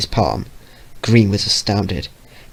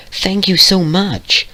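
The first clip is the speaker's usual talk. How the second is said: put-on grateful girl voice; 10 semitones higher, slower than usual. Purple pony thanks Vio.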